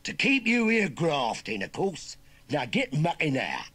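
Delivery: loud, slow, high Cockney command; bullying order. Acted. Nephew putting uncle to work, bullying. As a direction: lay it down slowly and loudly.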